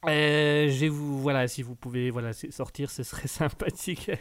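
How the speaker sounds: ordinary delivery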